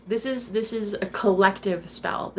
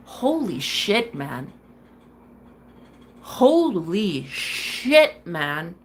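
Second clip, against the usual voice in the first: disbelief; 3 semitones lower, much slower than usual. Retold or imitated. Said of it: "I'm just thinking to myself".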